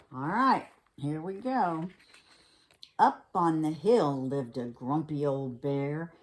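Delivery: ordinary delivery, nothing marked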